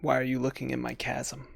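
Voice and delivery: hushed, lightly voiced unseen creature; voice in the head. Game master as a voice in the player's head, quiet. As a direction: speak softly, as if inside their head. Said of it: "you hear this voice in your head".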